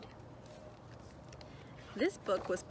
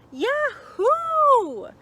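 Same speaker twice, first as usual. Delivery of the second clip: high, loud whoop of delight; joyful yell; 10 dB louder than usual. A narrator's reading. Little Chick cheering at his new siblings, loud high whoop. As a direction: shout it out with glee like a happy chick. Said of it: "yelled Little Chick".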